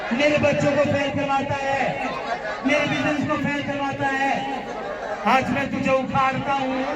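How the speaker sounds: preacher leading a rebuke prayer; deliverance prayer. Shown as a demonstration. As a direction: command the evil spirit out, for the crowd to repeat. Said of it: "मेरे साथ बोलना है".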